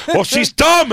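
put-on high snippy old woman voice; catty old lady. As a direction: sneer at the sister. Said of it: "They go".

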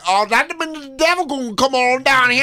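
mock woman's voice; mocking impression. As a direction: ham up the dim party guest. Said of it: "And she's like".

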